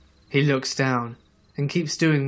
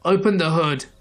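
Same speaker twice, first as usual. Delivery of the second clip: quick higher, louder retold shout; stranger's raised-voice command, moderately loud; yelling; 4 semitones higher, 5 dB louder than usual. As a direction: yell it as a brisk order. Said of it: "He yells".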